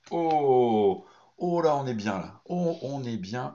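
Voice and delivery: long « oh » plus haut et fort; exclame-toi devant la belle carte; ravi, impressionné. Real, not acted. Réaction à une belle carte. (ravi et impressionné, voix qui monte)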